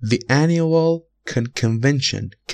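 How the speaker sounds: very slow, annual stressed and falling; drawn out for the class; slow example reading. Shown as a demonstration. Teacher starts the first example very slowly. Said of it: "مثال اول".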